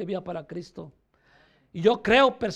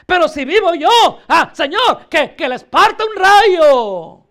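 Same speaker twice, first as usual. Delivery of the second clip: louder, higher, slow hammed-up curse; mock angry curse; 5 semitones higher, 9 dB louder than usual. Congregant praying to the Lord, hammed-up furious curse. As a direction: call down lightning in mock fury.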